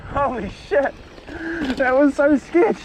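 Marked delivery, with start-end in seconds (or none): none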